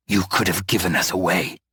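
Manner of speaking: low, hissed rebuke; a sharp low hiss of blame. A narrator's reading. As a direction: Arwen hisses his anger at Hector. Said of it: "he hissed".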